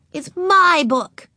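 put-on high, loud, drawn-out little-girl declaration; announcing small child. A narrator's reading. Ramona announcing to Mother the book is hers, high and loud. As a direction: announce it slowly like a proud little kid. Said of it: "she announced".

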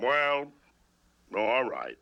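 low, reluctant giving in; deep, begrudging consent; grudging. Acted. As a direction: concede slowly after a pause, pitch dropping. Grudgingly forgives his wife.

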